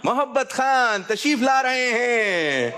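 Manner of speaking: long drawn-out, high-pitched announcer call; grand announcement. Pretend. Host ushers Muhabbat Khan up before the crowd, grand announcing call. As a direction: stretch it out like a showman's introduction.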